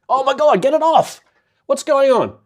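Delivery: very high, loud fake panic; hammed-up horrified yelp; mock horror. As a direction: cry out in pretend alarm at a blunder. Feigned panic over image on screen.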